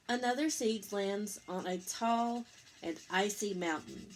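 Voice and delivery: gentle picture-book read-aloud; reading to children. A narrator's reading. Parent reading Eric Carle to Lucas, gentle storytelling.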